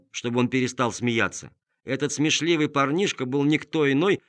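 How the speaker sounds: usual speaking voice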